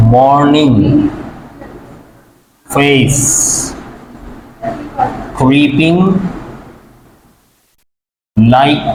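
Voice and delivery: word by word, long pauses between; slow dictation. Shown as a demonstration. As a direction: dictate it slowly for writing down.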